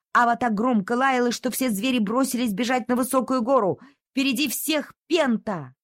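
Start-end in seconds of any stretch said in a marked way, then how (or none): none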